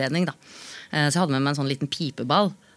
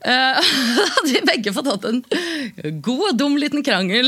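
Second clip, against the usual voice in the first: laughing; 7 semitones higher, 4 dB louder than usual. Real, not acted. Laughing at the story's end.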